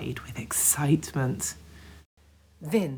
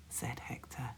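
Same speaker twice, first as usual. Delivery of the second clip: ordinary delivery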